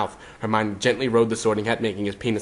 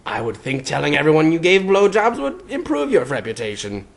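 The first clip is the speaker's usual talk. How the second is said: mildly sage, knowing tone; lightly voiced know-it-all hat; 6 semitones higher, 3 dB louder than usual. A narrator's reading. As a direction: say it as if dispensing wisdom. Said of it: "the Hat finished sagely".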